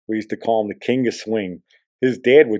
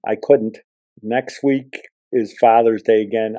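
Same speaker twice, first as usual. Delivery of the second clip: normal talking voice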